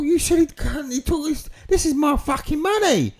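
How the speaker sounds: high, loud acted anger; indignant outrage. Acted outrage over money.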